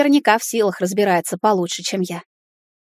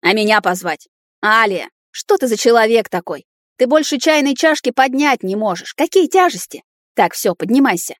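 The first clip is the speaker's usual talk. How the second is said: scolding; 3 semitones higher, 3 dB louder than usual. Acted. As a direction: tell her off in exasperation. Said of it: "в сердцах плюнул и заругался".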